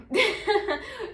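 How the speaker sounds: loud, high burst of laughter; amused laugh. Real, not acted. Laughs wryly at how it ended.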